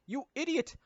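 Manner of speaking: high mock scolding. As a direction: snap at the idiot. Scolding a character.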